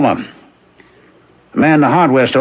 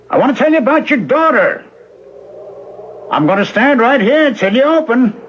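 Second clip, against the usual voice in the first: calling through the door; 7 semitones higher than usual. Acted. Man at the locked door urging Missus Turnbull to open, calling out. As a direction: call up to her through the shut door insistently.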